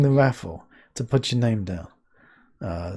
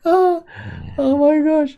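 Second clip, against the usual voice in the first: laughing; 11 semitones higher, slower than usual. Real, not acted. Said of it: "I'm laughing so much".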